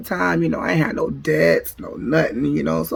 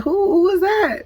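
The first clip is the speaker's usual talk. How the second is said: high-pitched nosy query; suspicious questioning; 14 semitones higher than usual. Retold or imitated. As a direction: ask it high, demanding to know. Quizzing him about the woman.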